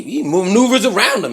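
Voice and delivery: usual speaking voice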